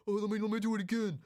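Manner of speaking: put-on high, loud, eager stunt-kid voice; reckless stunt friend. Imitating his friend after a hard landing, high and eager.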